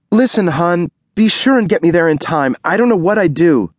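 scared wife. Acted. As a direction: beg him to get her there in time. Ruby voiced, scared.

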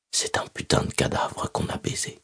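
hushed whisper of a memory. Acted. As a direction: whisper it like a haunting echo. Attacker's line remembered.

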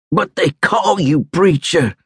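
high, slow insistence; insistent. A narrator's reading. Wounded soldier argues that the medic is a preacher, insistent. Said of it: "Joe insisted".

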